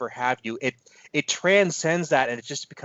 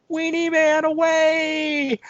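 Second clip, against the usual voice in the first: much higher, slow drawn-out yell; high slow shout; 5 dB louder, much slower than usual. Retold or imitated. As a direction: belt it out high and slow like a cartoon hero. Quoting a movie catchphrase.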